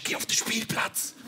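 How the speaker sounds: slow, whispered mock confession; conspiratorial whisper. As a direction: whisper it like a secret plan. Confides a criminal plan to friend. (verschwörerisches Flüstern)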